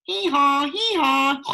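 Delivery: hammed-up donkey bray. A narrator's reading. Donkey noise game.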